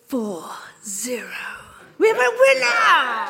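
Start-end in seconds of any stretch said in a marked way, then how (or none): 2.0-3.3 big high excited cry; thrilled game-show announcer; excited announcement. Real, not acted. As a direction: announce the winner with a burst of glee. Host announces the winning number.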